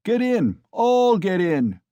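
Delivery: loud high shout, slowing; crying out. A narrator's reading. Mikolka crying out to the crowd. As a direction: roar the order. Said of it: "cried Mikolka".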